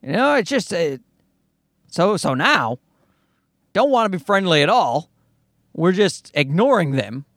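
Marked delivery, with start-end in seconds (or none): none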